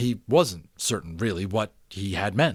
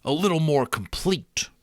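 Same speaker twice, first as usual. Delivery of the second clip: Giver suggesting; 8 semitones higher, 6 dB louder than usual. A narrator's reading. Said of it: "the giver suggested".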